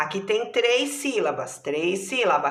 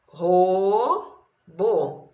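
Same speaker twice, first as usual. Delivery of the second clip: robô split, long ro, pause, then bô; syllable by syllable. Shown as a demonstration. Teacher showing two syllables, syllable by syllable. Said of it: "Duas sílabas".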